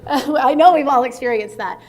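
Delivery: laughing. Real, not acted. Laughing after anecdote.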